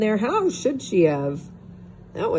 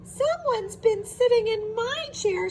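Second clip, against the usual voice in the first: very high squeaky little bear; squeaky Baby Bear. A narrator's reading. Baby Bear finding his chair, very high squeak. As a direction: squeak it like a tiny cub. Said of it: "squeaked Baby Bear".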